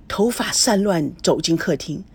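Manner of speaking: ordinary delivery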